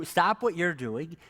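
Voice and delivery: usual speaking voice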